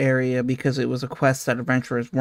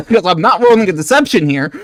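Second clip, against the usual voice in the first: fast, loud, laughing through words; 5 semitones higher, 8 dB louder, faster than usual. Real, not acted. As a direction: laugh while you say it. Laughing while talking.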